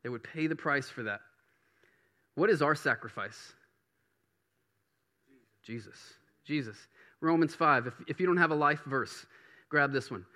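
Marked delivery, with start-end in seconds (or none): 5.6-6.0 much softer, low and reverent; soft lowered reverent voice; hushed reverent answer. Real, not acted. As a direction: breathe the name quietly. Answers own question, hushed.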